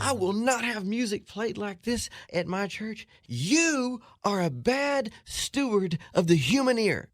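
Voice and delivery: high, slow, stretched-out indignant proclamation; mock a pompous music minister's outrage; imitated yelling. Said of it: "yelling at me".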